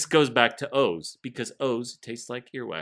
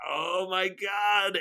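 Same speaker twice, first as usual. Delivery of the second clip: mock shocked friends; 9 semitones higher, much slower than usual. Friends react to drinking.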